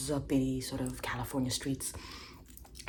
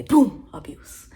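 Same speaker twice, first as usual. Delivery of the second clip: slow, loud, higher mock exclamation; mock triumphant shout; 8 semitones higher, 14 dB louder, much slower than usual. Gloating over a legal trick, loud mock shout. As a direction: slam it down like a winning card.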